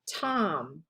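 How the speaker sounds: "Tom" begun on a plain T, low and soft; silent P. Shown as a demonstration. Teacher reading out P T O M, silent P. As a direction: say "Tom" with no P before the T. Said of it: "you don't pronounce that P".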